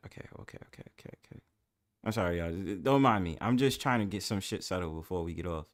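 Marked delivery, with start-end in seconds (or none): 0.1-1.4 soft whispered repeated okays. Real, not acted. Host whispering to calm himself down. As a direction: whisper to steady yourself.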